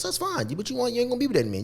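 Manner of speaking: ordinary talk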